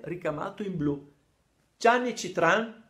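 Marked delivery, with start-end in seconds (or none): 1.8-2.8 slow, much higher and louder, accusing; a priest calling out the culprit's name; stern priest reading a name. Retold or imitated. Il parroco legge il nome. (parroco accusatorio, lento e molto più forte)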